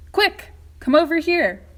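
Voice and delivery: high, loud hurrying call; urgent call. Acted. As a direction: hurry them across the room. Wonka urging them over.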